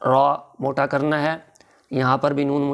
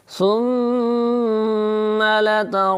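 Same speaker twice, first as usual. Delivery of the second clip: melodic chanted recitation; तजवीद से तिलावत; 7 semitones higher, much slower than usual. Shown as a demonstration. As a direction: recite it sung for the class to repeat. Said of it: "आप भी कोशिश कीजिए". (सुरीली तिलावत)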